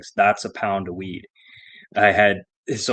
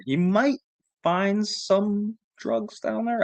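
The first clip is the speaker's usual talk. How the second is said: higher, slow sly tease; mock innocent slow hint; sly teasing; 9 semitones higher, slower than usual. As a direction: drag it out to taunt the cops. Teasing the cops in retelling.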